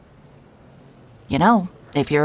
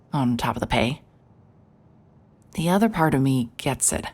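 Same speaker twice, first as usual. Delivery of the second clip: low, quiet, weary private eye musing to himself; noir inner narration; 3 semitones lower, 3 dB quieter than usual. A narrator's reading. Investigator narrating his drive to the desert town, low noir voiceover. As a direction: tell it like a tired detective narrating his case.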